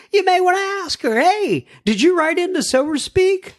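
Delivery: high chirpy mock question to Christine; playful put-on voice. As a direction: pretend to quiz a stranger, tongue in cheek. Imagined asking Christine.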